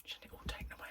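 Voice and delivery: hushed, scandalised whisper; old women gossiping over the fence, whispering. Retold or imitated. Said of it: "and they go like".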